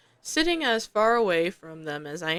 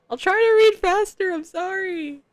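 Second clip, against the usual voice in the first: flustered apology; 11 semitones higher, 7 dB louder than usual. Real, not acted.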